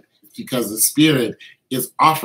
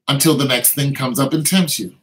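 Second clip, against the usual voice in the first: usual speaking voice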